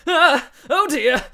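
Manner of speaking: high, loud alarmed yelp; startled hero yelping in panic; Ah and Oh dear cried high and loud; panicked cry. Acted. Hounds rush at Phil.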